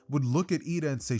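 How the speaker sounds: usual speaking voice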